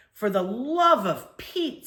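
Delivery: slow, exasperated sigh of a mother. A narrator's reading. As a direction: drag out the mother's weary exasperation. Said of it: "said my mother".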